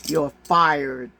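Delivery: lightly voiced TV host firing someone; lightly imitated reality-show firing line; mock Trump catchphrase. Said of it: "that was the most stupidest reality show".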